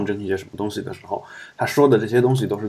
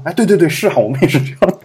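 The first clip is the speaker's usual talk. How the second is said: eager agreement; 7 semitones higher, 5 dB louder than usual. Retold or imitated. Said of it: "那美国人说".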